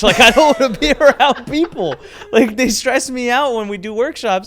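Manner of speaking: half-laugh the admission out; laughing while confessing to a partner; laughing confession. Retold or imitated. Retold confession of disliking people.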